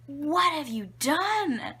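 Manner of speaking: high, loud, slow wail of horror; astounded mom. Retold or imitated. Mom to baby who played with poop, astounded. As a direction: imitate a mother appalled by her child. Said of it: "she's astounded".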